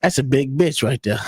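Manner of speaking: normal talking voice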